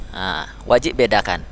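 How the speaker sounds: usual speaking voice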